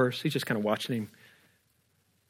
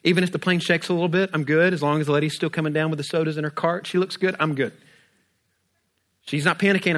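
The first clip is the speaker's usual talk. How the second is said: normal talking voice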